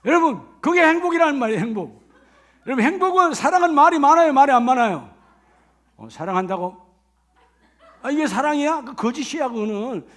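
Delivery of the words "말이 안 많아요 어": normal talking voice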